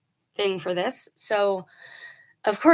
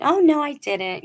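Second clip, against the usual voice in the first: higher, louder mock-innocent protest; fake innocent denial; 7 semitones higher, 5 dB louder than usual. Bully girl denying it to the adults, higher and louder, fake innocent. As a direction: play the sly girl acting sweet for the adults. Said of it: "real wicked".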